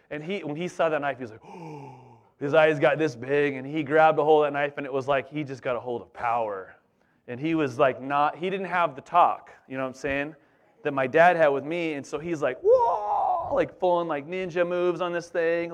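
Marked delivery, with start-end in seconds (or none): 1.5-1.9 mock gasp. Friend gasps at knife. 12.6-13.5 a high shouted whoa; mock kid's whoa; excited whoa. Friend excited by knife.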